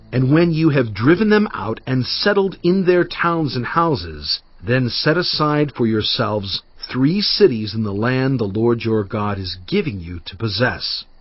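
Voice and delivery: usual speaking voice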